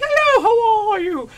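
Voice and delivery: high, loud falsetto greeting; mock English lady. Second English woman answers shop girl, mock falsetto gush. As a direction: sing it out in exaggerated falsetto.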